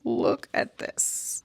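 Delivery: "Look at this." breathed as a high, slow whisper; awed whisper. Shown as a demonstration. Spotting something online, showing viewers in a slow high whisper. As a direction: hush it like you've found a treasure.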